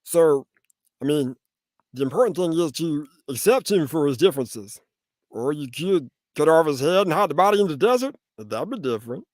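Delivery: Meatwad impression, rising to a loud high squeal. Voice actor obliging a fan's request, cartoon Meatwad voice. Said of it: "do a Meatwad voice".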